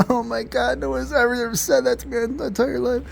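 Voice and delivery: put-on high, teary falsetto of a girl overwhelmed by kindness; a high crying girl voice, imitated; imitated bawling. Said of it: "like, crying, like, bawling".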